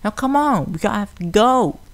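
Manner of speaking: high, pressing filly pleading to go; urgent filly voice. A narrator's reading. Filly urging friends to leave, high and pressing. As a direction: hurry the others along in a high voice.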